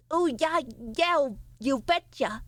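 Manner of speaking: slow, drawn-out Midwest "you betcha"; mock Midwest accent. Streamer jokingly answers chat in a mock Midwest accent. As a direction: play up a folksy Midwest accent, slowly. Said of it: "Is the Midwest accent the don't you know one?".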